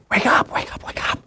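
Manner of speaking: whispered wake up, pressing and quick; urgent whisper. Retold or imitated. Wife whispering to sleeping husband about the rat, urgent whisper. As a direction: hiss him awake in the dark.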